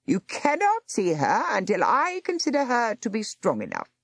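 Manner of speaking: high, cold voice of a nurse barring the door; stern nurse. Acted. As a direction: refuse like a gatekeeper. Stern nurse refusing entry.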